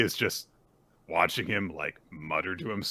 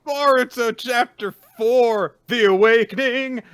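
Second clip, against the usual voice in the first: chapter announcement; 11 dB louder than usual. Acted. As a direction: announce it like a show title.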